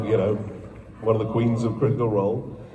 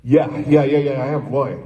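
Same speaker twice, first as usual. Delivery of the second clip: higher, louder, tumbling over the yeahs; eager excited yes; 5 semitones higher, 9 dB louder than usual. Retold or imitated. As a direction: jump at the offer without playing it cool. Eager reply to casting director.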